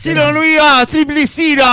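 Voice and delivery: high, drawn-out promo chant; shouting announcer. Acted. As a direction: blare out the contact details like an announcer. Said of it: "¿Pero por qué gritas ahí al lado?".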